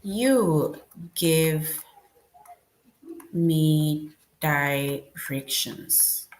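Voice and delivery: very slow, drawn-out words; teacher dictating a rewritten sentence slowly; slow dictation. Shown as a demonstration. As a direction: stretch each word out slowly. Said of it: "we can start with the capital letter C".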